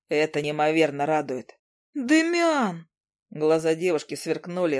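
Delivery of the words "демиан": much higher sharp call of his name; Izi's call. Acted. Izi calling Demian off the groom, high and sharp. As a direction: snap his name to stop him.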